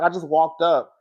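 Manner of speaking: hushed quote of Riley explaining; quiet explaining. Retold or imitated. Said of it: "She was like".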